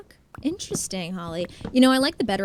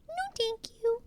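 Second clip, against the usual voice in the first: much higher, put-on voice; high mock-polite refusal; 13 semitones higher, 5 dB quieter than usual. Streamer turning down chat in a high put-on voice. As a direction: repeat it up high as a silly refusal.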